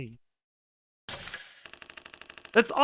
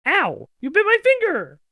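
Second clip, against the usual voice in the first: sharp high yelp of real pain, much louder; 12 semitones higher, 8 dB louder than usual. Real, not acted. Streamer yelping at her dog after it bites her finger. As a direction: yelp as the teeth catch your finger.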